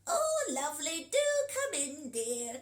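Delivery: wolf pretending to be grandma; croaky granny wolf. Shown as a demonstration. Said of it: "You have a go ... the wolf pretending to be Grandma".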